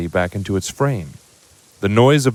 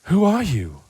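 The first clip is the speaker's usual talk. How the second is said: put-on awed boy; 8 semitones higher than usual. Harry asking the giant who he is, high and hushed. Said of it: "what he said instead was".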